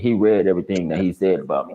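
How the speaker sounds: ordinary talk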